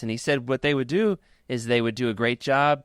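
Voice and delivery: ordinary delivery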